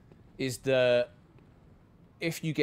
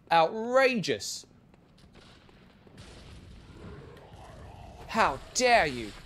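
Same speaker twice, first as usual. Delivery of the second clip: slow, high indignant aristocrat; drawn-out snooty scorn; a hint of an offended lord; outraged Percy; 5 semitones higher, slower than usual. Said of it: "He gets particularly angry when, uh, peasants try".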